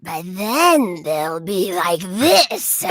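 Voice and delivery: very slow, creepy witch; scary witch voice. Shown as a demonstration. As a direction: drag it out like a wicked witch. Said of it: "scary, gravelly voice texture".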